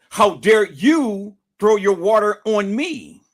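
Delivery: indignant retort. Retold or imitated. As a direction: throw her words back.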